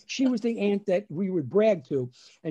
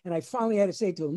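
normal talking voice

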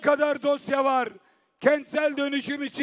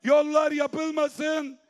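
usual speaking voice

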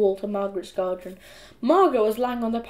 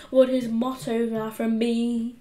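plain reading voice

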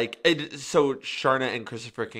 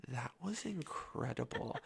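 very soft, slow imitated praise; quiet retold amazement; hushed awe; 15 dB quieter, slower than usual. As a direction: murmur it slowly as if amazed. Impressed girl's reaction, imitated.

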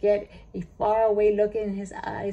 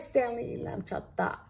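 plain reading voice

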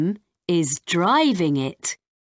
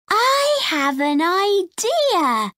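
put-on much higher, eager little girl; bright girl voice; 11 semitones higher than usual. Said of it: "says Rosie".

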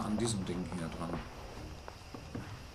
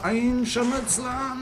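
high, slow panicked squeal of "Ein Scharmützler"; mock panic; 5 dB louder, slower than usual. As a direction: play it like a shrieking coward. Repeated in a higher panicked voice.

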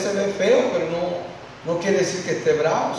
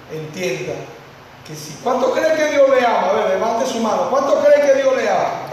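ordinary delivery

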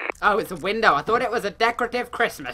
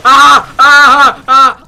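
startled screams; 7 semitones higher, 22 dB louder, slower than usual. Real, not acted. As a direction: run of short, very loud high screams. Screams at something in the game.

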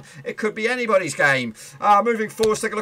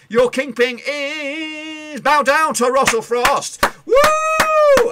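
higher and louder, slow and triumphant; excited announcement; 11 semitones higher, 7 dB louder, much slower than usual. Acted. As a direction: roep de winnaar uit alsof het een prijsuitreiking is. Announcing the week's winner.

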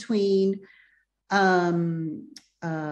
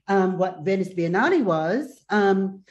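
ordinary talk

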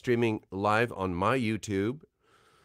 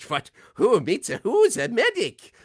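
high, loud, indignant German outburst; German Medic voice; 13 semitones higher, 7 dB louder than usual. Acted. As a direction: react as an incredulous German character. Medic character's German-accented reaction, acted.

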